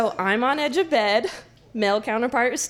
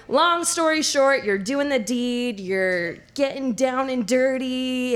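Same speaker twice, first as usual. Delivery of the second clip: usual speaking voice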